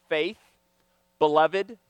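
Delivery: usual speaking voice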